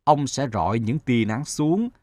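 put-on wise old sun. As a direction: explain gently, like an elder. Sun counsels.

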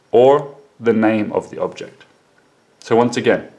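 ordinary talk, nothing marked

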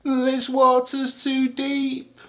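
high, slow little wail; acted baby elephant in trouble; spluttering alarm. A narrator's reading. Baby elephant out of her depth, high alarmed voice. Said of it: "spluttered the baby elephant".

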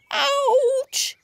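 high-pitched story-time yelp; acted yelp of pain; pained cry. A narrator's reading. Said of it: "cried Dewi as he hobbled over to Scoff".

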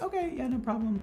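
high, light mock-agreeable voice; agreeable reply. Stylist answering a client's hair request, agreeable. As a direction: imitate an eager-to-please yes.

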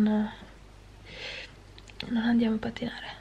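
unvoiced hushed whisper. Real, not acted. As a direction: whisper it so nobody hears. Whispering while someone nearby.

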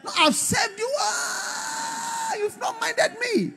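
hammed-up, very high crying complaint; bitter wailing prayer. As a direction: wail accusingly at God, drawing it out. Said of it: "'cause you can pray bitterness".

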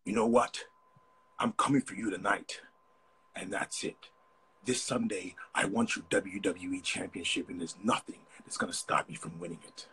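wrestling promo in an American accent; American wrestler accent. Shown as a demonstration. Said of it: "I can only do an American accent when I'm trying to be a wrestler".

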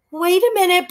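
put-on high-pitched squeaky boy voice; high squeak. Frightened boy squeaking at the hairy man. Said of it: "in a high-pitched, pitched squeak".

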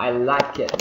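"I like it" with a strong, separate K in "like"; over-pronounce the K in "like", loudly; wrong-way demo, "like" ending in a hard released K; full K sound. Shown as a demonstration. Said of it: "không phải là".

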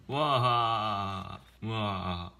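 drawn-out acted "wah" sob; mock crying wail. Chief breaking down at the farewell, acted bawling. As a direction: burst into a storybook wail. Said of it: "she's really crying".

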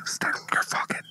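right in your ear. Acted. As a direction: whisper the secret close. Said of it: "I'm gonna whisper in her ear".

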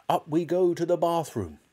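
high, rallying call; excited cry. A narrator's reading. Father leads his son upstairs, excited high cry. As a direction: sing out eagerly, pitch up. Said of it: "cried Mister Killycranky".

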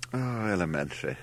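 understated, self-deprecating falling sigh. A narrator's reading. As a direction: sigh it out, lightly dismissive. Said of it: "with a deprecating sigh".

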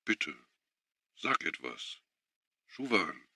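a soft, quiet plea; softly pleading. A narrator's reading. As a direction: beg her softly to speak. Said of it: "bat er leise". (leise bittend)

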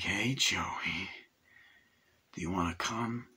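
hushed, softly imitated phone call; low-key, near-whispered invitation; William Hurt. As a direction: quietly coax him to visit. William Hurt calling Joey, retold.

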